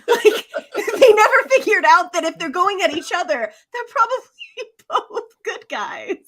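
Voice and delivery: words squeezed out high through laughter; can barely finish the line for laughing. Real, not acted. Telling friends about the players, real helpless laughter.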